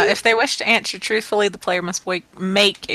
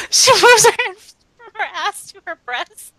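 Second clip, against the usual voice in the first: usual speaking voice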